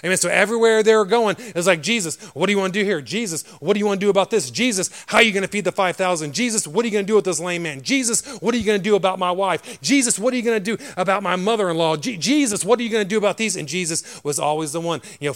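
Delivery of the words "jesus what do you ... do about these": raised, insistent volley of requests; pestering questions. Acted. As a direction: pester Jesus like needy disciples. Acts out needy disciples' questions.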